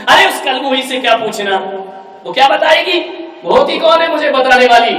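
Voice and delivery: loud, high contemptuous shout; angry bride mocking in-laws; scornful outburst. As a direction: spit out insult at mother-in-law. Daughter scorns mother-in-law.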